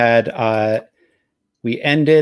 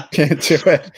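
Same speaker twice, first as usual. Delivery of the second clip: laughing; 5 semitones higher than usual. Real, not acted. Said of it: "I can't do it".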